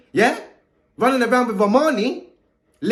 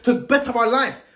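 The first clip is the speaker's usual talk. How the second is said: normal talking voice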